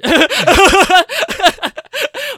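loud, very high laughter; big laughing fit. Real, not acted. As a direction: crack up helplessly at your own joke. Laughs at own retort.

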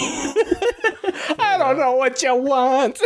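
high, very slow throaty wail; hammed-up throaty character voice; wide-open throaty. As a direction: open the throat and drag it out, laughing. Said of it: "you can really open up that throat".

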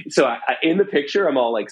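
ordinary talk

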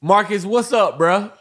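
loud, high call to a friend; hey Marcus, yelled high and loud. Retold or imitated. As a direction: shout his name across the hall. Calling out to Marcus.

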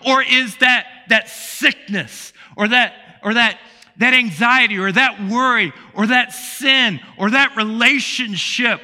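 building sermon fervour; full-force preaching. Acted. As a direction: preach it up to the back row. Preaching to congregation, raised voice.

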